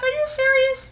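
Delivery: high, soft incredulous squeak; mock disbelief. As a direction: vlogger reacting to British's question, high and disbelieving. Host's incredulous reaction.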